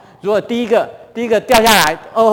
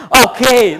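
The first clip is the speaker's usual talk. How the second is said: forced okay; 5 semitones higher, 11 dB louder than usual. Retold or imitated. Gasping climber insists he is fine.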